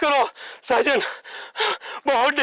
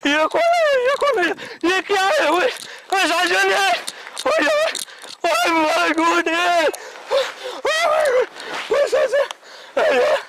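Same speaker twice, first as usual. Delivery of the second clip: high, frightened wail; panicked calling; 6 semitones higher, slower than usual. Acted. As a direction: call your friend awake in terror. Frightened, rousing sleeping friend. (डर के मारे ऊँची आवाज़ में पुकार)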